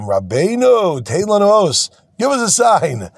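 high, drawn-out mock plea; pleading. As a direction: beg the rabbi, hammed-up. Acts out students begging for sign.

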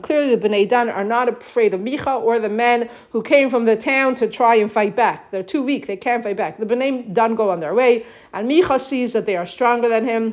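ordinary delivery

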